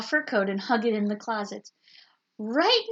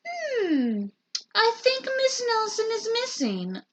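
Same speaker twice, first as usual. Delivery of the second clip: dopey detective; 13 semitones higher than usual. A narrator's reading. Voices dim detective.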